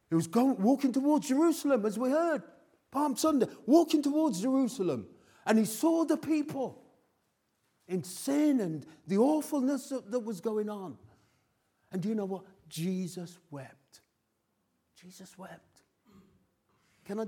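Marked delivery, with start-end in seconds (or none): 0.1-11.0 high, loud preaching over the congregation; fervent preaching. Real, not acted. Preaching at full force. 15.0-15.8 dropped almost to a breath; hushed echo. Real, not acted. Repeating for effect.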